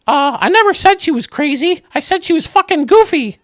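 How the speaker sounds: high, loud squeaky cartoon mouse; Mickey voice. Mickey answering the judge, high loud squeaky cartoon voice.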